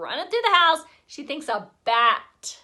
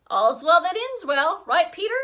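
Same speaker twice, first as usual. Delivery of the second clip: cheerful; 6 semitones higher, 6 dB louder than usual. A narrator's reading. Sheila cheerfully to Peter.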